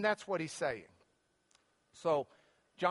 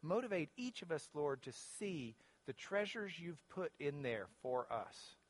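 lower-pitched prayer with long pauses; closing prayer; 7 semitones lower, 4 dB quieter than usual. Real, not acted. Pastor leading the group in prayer to the Father, prayerful. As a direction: pray aloud unhurriedly, easing softer toward the amen.

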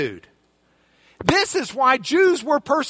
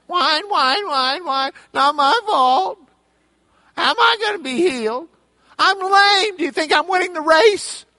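whiny sick man; 5 semitones higher than usual. Retold or imitated. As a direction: whine like a self-pitying invalid. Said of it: "He's a whiny pants".

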